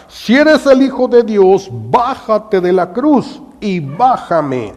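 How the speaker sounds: very high, loud, desperate demand; Gestas shouting. Acted. Gestas yelling at Jesus in despair, loud. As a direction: yell it up at Jesus. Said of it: "Gestas grita".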